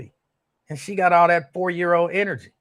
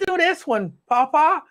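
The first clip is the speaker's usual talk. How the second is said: put-on much higher little-girl voice, puzzled question; imitating a four-year-old girl; 13 semitones higher, 5 dB louder than usual. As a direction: squeak it out like his small granddaughter. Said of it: "And she would say".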